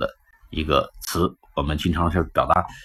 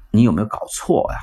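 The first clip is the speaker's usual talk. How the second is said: usual speaking voice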